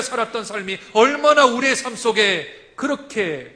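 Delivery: loud, rising sermon cry; full-force preaching. Acted. Preacher proclaiming to the congregation, loud. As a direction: preach it out to the whole church.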